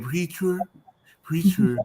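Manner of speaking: "Preacher" with a put-on Spanish accent; imitated Spanish accent. Host copies guest's word, "Preacher" in Spanish accent. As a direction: say "Preacher" as a Spanish speaker would. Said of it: "like you said".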